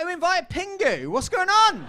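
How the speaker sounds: loud, high, incredulous aside; mock bafflement. Comic retelling Christmas with his partner's family, baffled and loud. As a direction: blurt it out, baffled at the table. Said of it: "I was like".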